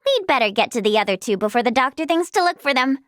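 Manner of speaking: normal talking voice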